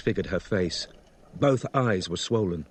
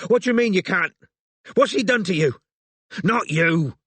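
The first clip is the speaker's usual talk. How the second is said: high, agitated raised voice; bellowing; 8 semitones higher, 4 dB louder than usual. A narrator's reading. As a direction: demand it in a frantic bellow. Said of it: "he bellowed at the confused cabbie".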